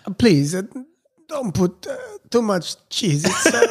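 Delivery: mock Italian waiter. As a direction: ham up an Italian waiter with a jokey Italian accent. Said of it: "I had a similar experience".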